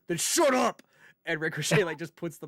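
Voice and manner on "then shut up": sharp mock yell, higher and louder; imitating a shout. Retelling Drew's promo to his co-host, a mock shouted put-down. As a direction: bark it like a fed-up wrestler cutting someone off. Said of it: "And Drew goes".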